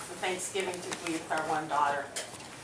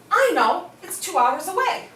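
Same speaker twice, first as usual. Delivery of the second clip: high and loud, jumping in eagerly; a stranger butting in, bursting to help; eager interjection; 9 semitones higher, 7 dB louder than usual. Retold or imitated. Woman at the next table jumping in, eager and loud.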